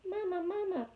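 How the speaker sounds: put-on high, tiny baby-bird cry for its mother; peeping gosling. A narrator's reading. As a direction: peep it out high like a newborn gosling. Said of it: "peeped the gosling".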